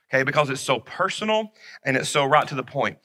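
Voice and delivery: usual speaking voice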